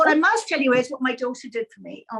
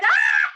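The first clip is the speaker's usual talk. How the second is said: gleeful shriek; 7 dB louder than usual. Retold or imitated. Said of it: "And she went".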